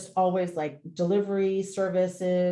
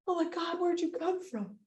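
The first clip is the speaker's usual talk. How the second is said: much higher, soft amazed question; breathless wonder; 9 semitones higher, 6 dB quieter than usual. Retold or imitated. Owner thanking the mysterious rescuer, high and hushed. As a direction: marvel at him, hushed. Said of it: "And I say".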